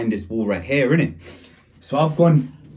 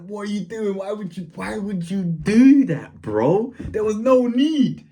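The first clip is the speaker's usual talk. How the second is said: high-pitched, drawn-out complaint; acted-out indignant whine; exasperated protest; 8 semitones higher than usual. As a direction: plead in disbelief at being given away. Protests friend giving him away.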